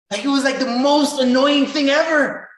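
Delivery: high, loud, fed-up cry; exasperated outburst. Real, not acted. Young man recalling his mentor walking away, loud and exasperated. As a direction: vent the frustration full force.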